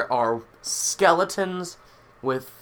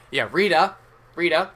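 louder, higher call to someone away; 5 semitones higher, 5 dB louder than usual. Acted. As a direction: summon the maid from across the room. Calls the maid over.

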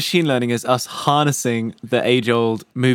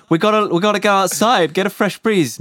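high mock voice; 8 semitones higher than usual. Voicing the iPad.

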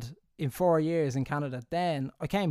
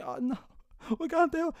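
mock panic; 13 semitones higher than usual. Panicking at work before seizure.

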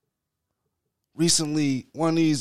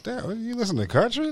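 daughter's disbelief; 10 semitones higher than usual. Retold or imitated. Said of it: "even my daughter was like".

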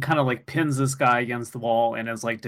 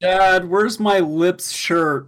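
yelling across the room; 5 semitones higher, 6 dB louder, much slower than usual. Pretend. Kid calling to dad.

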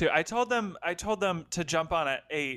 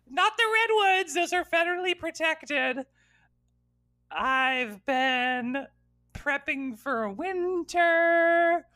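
put-on bright, higher girlish storybook voice; Little Red voice; 7 semitones higher than usual. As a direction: play a giddy fairy-tale girl. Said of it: "Little Red's joining".

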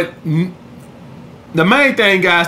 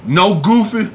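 normal talking voice

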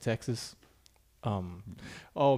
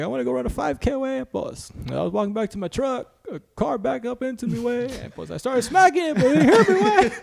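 high put-on voice, building louder; Javi impression; 11 semitones higher, 5 dB louder than usual. Buddy recounting being hit, high impression getting louder. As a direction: keep up the friend's high voice, louder as it goes. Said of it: "this dude actually talks like this".

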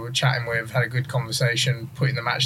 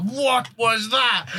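slow, higher, baffled; stunned question; 9 semitones higher, much slower than usual. Retold or imitated. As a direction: drawl it out in disbelief. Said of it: "he just looks up at me like".

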